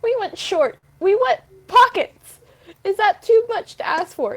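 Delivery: playful indignant plea; mock outrage. Real, not acted. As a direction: rant about pockets, half joking. Complaining about pants pockets.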